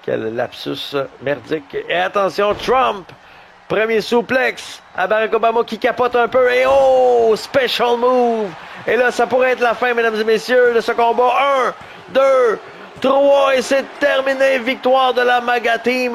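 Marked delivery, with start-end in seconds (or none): 1.9-6.5 high, lively fight commentary; live play-by-play. Acted. 6.5-16.1 boxing ring announcer. Acted. Count-out and victory call.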